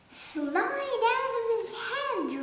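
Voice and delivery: ordinary delivery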